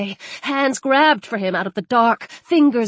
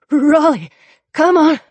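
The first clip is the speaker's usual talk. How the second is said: louder urgent call; 4 semitones higher, 5 dB louder than usual. Acted. Freezing man calls his dog out loud.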